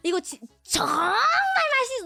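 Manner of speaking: fast, high, shouted delight; intense Korean praise. Retold or imitated. Imitating Korean parents shouting that food is delicious. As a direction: yell how delicious it is like crazy. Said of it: "make it sound more intense".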